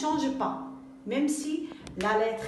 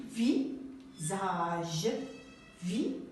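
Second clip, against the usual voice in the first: "visage" read slowly and softly; slow sounding out; 4 semitones lower, 5 dB quieter, much slower than usual. Shown as a demonstration. Teacher to pupils, modelling the word slowly and softly. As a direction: softly draw out "visage" so the z is heard. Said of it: "regardez ici".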